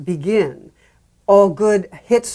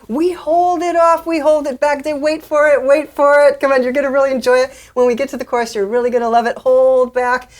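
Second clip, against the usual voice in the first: higher, held-level build-up voice; teasing anticipation; 6 semitones higher, 4 dB louder than usual. Acted. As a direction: tease them, holding back the payoff. Acting out a pre-chorus teasing the listener.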